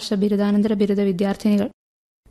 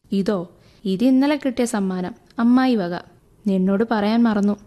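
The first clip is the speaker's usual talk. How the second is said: ordinary delivery, nothing marked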